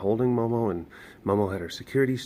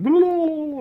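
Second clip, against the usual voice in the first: much higher, louder gibberish; mock loud chatter; 10 dB louder than usual. Showing what keepers can't do, loud gibberish. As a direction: squeal nonsense at full volume. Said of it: "we can't go like".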